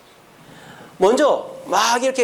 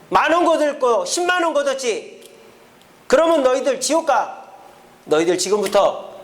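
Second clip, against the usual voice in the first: raised, higher scolding voice; preacher's mock rebuke; 5 semitones higher than usual. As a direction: thunder at the tax collectors like a stern prophet. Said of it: "세리들에게도 호통을 칩니다".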